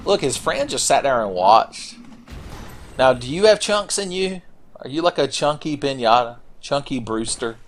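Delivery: usual speaking voice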